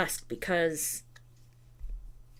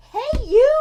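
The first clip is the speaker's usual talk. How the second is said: loud high call to the dog; 10 dB louder than usual. Real, not acted. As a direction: call out sharply to get attention. Calling out to the dog.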